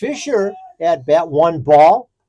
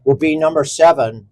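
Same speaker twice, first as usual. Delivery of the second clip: lineup announcer; 3 semitones lower than usual. Acted. Announces starting lineup formally.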